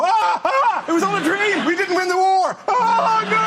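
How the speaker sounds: high shouting German, waking from a dream; panicked German accent. Retold or imitated. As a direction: yell in horror in a German accent. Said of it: "your variety of accents".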